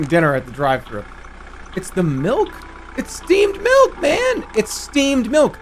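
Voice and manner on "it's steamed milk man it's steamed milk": slow, high, stretched-out disbelief; whiny drawn-out outrage at the game; exasperated high yell. Real, not acted. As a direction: plead with the screen that it's steamed milk. Frustrated at game marking order wrong.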